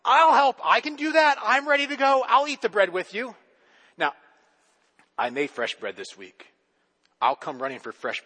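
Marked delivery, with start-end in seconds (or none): 0.1-3.3 louder and higher, tumbling in; eager animals. A narrator's reading. Animals rush to eat the bread, loud and eager. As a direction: everyone jumps at the chance. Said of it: "Everybody comes running".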